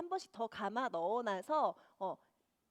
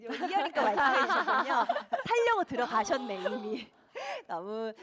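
laughing; 10 dB louder than usual. Real, not acted. Laughs at viewer's comment.

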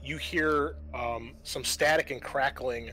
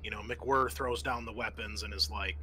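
ordinary delivery

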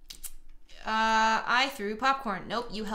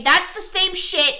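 loud, higher, exasperated; annoyed outburst; 7 semitones higher, 8 dB louder than usual. Real, not acted. Gamer fuming at the game's line. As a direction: snap it out in disgust. Angry outburst at game dialogue.

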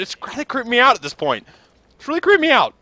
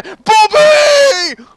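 mock yell; 10 semitones higher, 6 dB louder than usual. Slips back into Bobby yelling.

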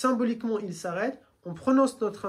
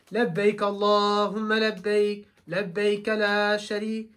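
slow, louder chant that carries; loud carrying talbiya; 5 dB louder, slower than usual. Shown as a demonstration. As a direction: call it out slowly with full voice. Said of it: "Mais normalement, c'est". (talbiya lente et forte, à voix qui porte)

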